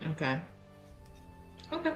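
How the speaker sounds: usual speaking voice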